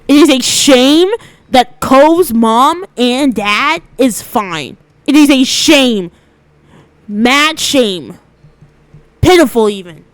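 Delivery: loud, slow mock mourning; dramatic loud lament. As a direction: wail it like a tragedy. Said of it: "so the camera can catch it".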